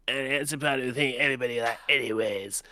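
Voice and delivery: imitating a deep vocal fry. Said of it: "really deep vocal fry".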